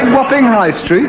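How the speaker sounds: very high, rising incredulous question; insistent astonished query, pitched right up; mock incredulity. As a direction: repeat it in high-pitched disbelief. Repeats the query over the interruption.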